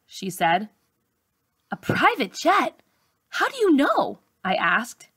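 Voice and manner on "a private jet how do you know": much higher, rising in disbelief; amazed girl asking the doctor, pitched high; surprised question. A narrator's reading. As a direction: ask it as if you can't believe it.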